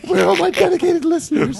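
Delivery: very high, loud mocking wail; hammed-up plaintive cry; mock lament. Joins in mocking the co-host.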